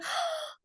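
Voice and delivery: high, hammed-up shocked gasp; dramatic gasp. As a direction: gasp in mock dismay, imitating a flustered friend. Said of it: "And she's like".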